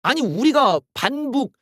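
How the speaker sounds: normal talking voice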